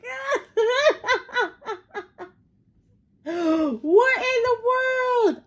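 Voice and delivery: real helpless laughter breaking into a high squeal; laughing hard. Real, not acted. Viewer reacting to the parking story, crying with laughter.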